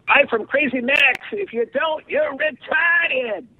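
high, wild pitchman voice; Crazy Max voice. Retold or imitated. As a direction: squeal it like a crazy TV huckster. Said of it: "it was a great tagline".

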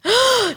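sharp high mock gasp; pretend gasp. Co-host faking surprise at the story. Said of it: "I will pretend like I have not".

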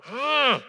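sharp high loud grunt of frustration; frustrated groan. Shown as a demonstration. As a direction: let out an exasperated ugh. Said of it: "You just go".

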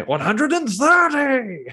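long, high, drawn-out darts caller bellow; darts score caller. Retold or imitated. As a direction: stretch it out like a darts MC. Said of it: "as a star dart announcer would say".

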